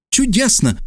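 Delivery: high, bright cry of delight; delighted exclamation. A narrator's reading. Geographer delighted at the news, high happy cry. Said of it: "воскликнул он".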